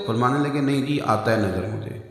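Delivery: a hint of an old man answering meekly; old man's mild reply. Old man on the road telling the caliph he can see, soft and low.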